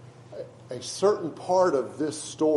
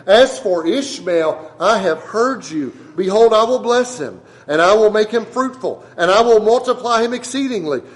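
loud, higher preaching of God's words; preaching scripture reading; 3 semitones higher, 9 dB louder than usual. Acted. Pastor reading God's promise to Abraham, loud preaching. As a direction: proclaim it like a pulpit declaration.

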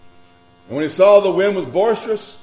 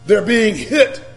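raised, driving preacher voice; higher, urgent sermon delivery; forceful preaching; 6 semitones higher, 4 dB louder than usual. Real, not acted. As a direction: build the pile-up, landing hard on key words. Preaches emotions piling up.

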